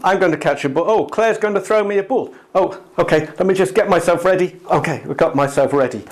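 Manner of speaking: bustle about preparing to catch; mock self-talk. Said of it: "let's do it with catching a ball".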